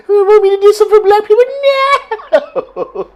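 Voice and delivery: high fast cackling witch voice. Retold or imitated. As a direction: screech it like a cartoon witch. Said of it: "She sound like a witch".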